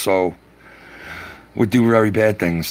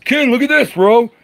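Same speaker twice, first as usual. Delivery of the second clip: put-on much higher and louder kid calling out; excited kid voice; 5 dB louder than usual. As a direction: call out like an excited boy showing off. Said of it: "He's like this".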